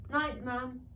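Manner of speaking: a little dragon's sleepy goodnight. Acted. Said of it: "said Dara".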